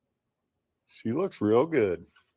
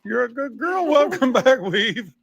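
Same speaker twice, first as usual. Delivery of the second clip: excited dog praise; 7 semitones higher than usual. Real, not acted.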